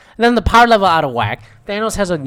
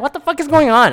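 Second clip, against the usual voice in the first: high-pitched retold bewilderment; exasperated confusion; 11 semitones higher, 4 dB louder than usual. Retelling their reaction to the gauntlet run, exasperated confusion. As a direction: exclaim it as if totally lost. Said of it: "I'm so confused".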